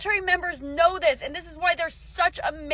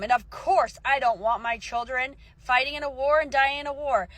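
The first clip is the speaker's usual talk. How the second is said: ordinary talk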